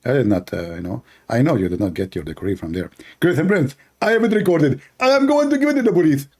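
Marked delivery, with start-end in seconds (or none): 3.2-6.3 high whiny caricature, fast at the end; mock debater tattling to the police; Zakir Naik. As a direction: rush the threat out, much higher. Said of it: "Okay, Zakir Naik".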